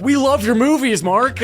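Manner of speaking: high, gushing fan voice; high-voiced adoring fans; starstruck. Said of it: "they were equally starstruck".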